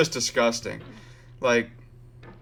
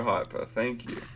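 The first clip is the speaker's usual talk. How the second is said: ordinary talk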